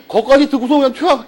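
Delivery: amused storyteller laughing. Real, not acted. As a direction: tell it while cracking up. Laughing while telling the rush.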